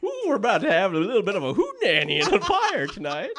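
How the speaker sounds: high, hammed-up fake chief voice. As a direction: put on a silly, badly faked chief impression. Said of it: "said the Indian chief".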